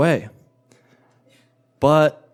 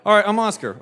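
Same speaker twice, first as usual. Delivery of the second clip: sarcastic; 8 semitones higher, faster than usual. Retold or imitated. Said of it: "I'm like".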